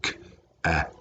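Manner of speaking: "k-at" in soft, separate sounds; "k-at" quietly broken apart; tapped out. Shown as a demonstration. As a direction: sound out "k-at" with gaps between sounds. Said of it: "let's tap it out first".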